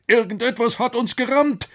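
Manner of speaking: high, alarmed professor's cry; startled storybook professor; alarmed call. Acted. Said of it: "rief der Professor".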